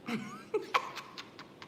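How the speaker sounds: breathy voiceless laugh. Real, not acted. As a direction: laugh it off with little voice. Laughs at insult about chin.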